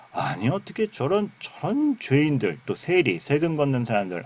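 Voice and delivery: high-pitched mock scandalised Pharisee; tut-tutting disapproval. Pharisees seeing Jesus with sinners, acted disapproval. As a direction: act appalled at such company, drawing words out. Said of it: "이렇게 끌끌끌 혀를 찼단 말이죠".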